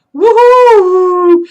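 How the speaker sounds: sudden loud, high owl hoot; sudden booming owl call; startling owl hoot. A narrator's reading. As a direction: blast a hoot to make him jump. Owl hoots at the squirrel.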